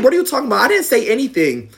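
high-pitched retold protest; mock-indignant, pitched high. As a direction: act out a teen denying it, voice way up. Acted quarrel, teen protesting.

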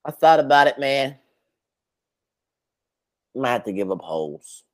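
normal talking voice

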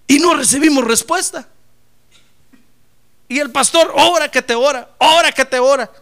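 loud, high preacher's lament; fiery preaching. Acted. Pastor telling the church about unanswered prayer, loud preaching.

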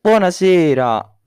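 loud drawn-out showman welcome; showman announcer. Acted. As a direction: call out like a ringmaster. Showman opening greeting to audience.

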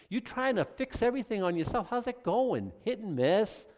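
normal talking voice

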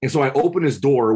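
ordinary delivery

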